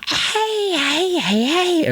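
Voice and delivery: put-on high, creepy baby voice. Shown as a demonstration. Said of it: "like, baby voice".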